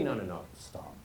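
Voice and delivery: low, soft, far-off mock protest; a deflated listener pleading. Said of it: "audience voice".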